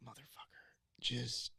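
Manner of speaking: whispered curse, barely voiced; a hushed, exasperated swear; under-breath whisper. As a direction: mutter it so only you hear. Muttered curse at wristband handlers.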